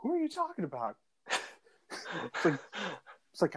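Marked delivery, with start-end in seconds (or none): 0.0-1.0 high-pitched puzzled question; mock-confused, voice lifted high; retold bafflement. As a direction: act out someone completely baffled, pitched way up. Retold puzzled reply about Scottish friend.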